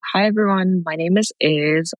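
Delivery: normal talking voice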